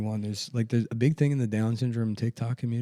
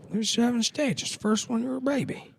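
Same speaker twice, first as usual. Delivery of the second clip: high, rambling old-man impression; Joe Biden; 13 semitones higher than usual. Confused president explaining the seven stages, mock old-man voice. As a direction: lecture muddledly in a put-on elderly voice.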